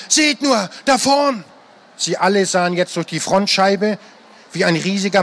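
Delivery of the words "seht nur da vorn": mild high cry of alarm; screaming. A narrator's reading. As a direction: warn them of the tree ahead. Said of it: "schrie sie nur".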